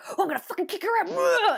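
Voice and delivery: high, fast imitation of a raging actor; hammed-up rant; mock furious yell. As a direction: act out the on-set meltdown, half-hammed. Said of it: "and you just hear".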